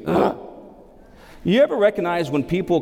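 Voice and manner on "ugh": short disgruntled "ugh"; grumbling grunt. Shown as a demonstration. Preacher showing the congregation what grumbling means, grumbling grunt. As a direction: let out a wordless grumble. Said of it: "That's what it means".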